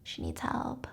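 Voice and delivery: soft conspiratorial whisper; hushed family plotting; whispered scheming. Retold or imitated. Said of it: "family members or friends who are like".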